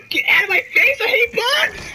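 terrified shriek at the bugs in the game; panicked scream. Real, not acted. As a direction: scream it at the bugs swarming you.

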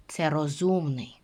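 ordinary talk, nothing marked

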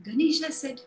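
usual speaking voice

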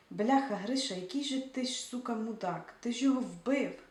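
loud, furious swearing; a raging rebuke of a reckless partner; angry cursing. Acted. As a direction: tear into him for killing the old man. Seva curses Grisha.

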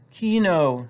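a wife's lightly husky call. A narrator's reading. Frightened wife appealing to her husband, husky. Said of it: "she said huskily".

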